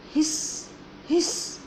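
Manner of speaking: soft breathy hiss; gentle snake hiss for kids. Shown as a demonstration. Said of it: "snake sound is".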